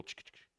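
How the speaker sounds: quiet little chattering noise; soft ch-ch-ch of a slight tug; imitated truck chatter. Driver telling how the truck tugged, imitated soft chatter.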